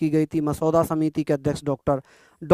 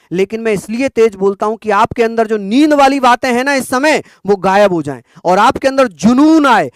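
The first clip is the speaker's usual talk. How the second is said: loud and higher, driving the students on; loud motivational push; 4 semitones higher, 7 dB louder than usual. Real, not acted. Teacher rousing sleepy students, loud and fervent. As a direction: fire them up, shout it with passion. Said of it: "मैं तेज इसलिए बोलता हूं".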